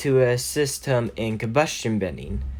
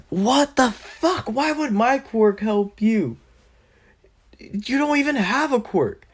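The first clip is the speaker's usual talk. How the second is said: very high, drawn-out mock indignation; incredulous outburst; 11 semitones higher than usual. Bakugo is asked to lend his quirk, incredulous high outburst. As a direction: squawk it in stretched, high disbelief.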